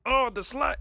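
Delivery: high, loud attempt at an announcer call; Dave Johnson. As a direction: try a belted dunk exclamation. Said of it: "that was all right".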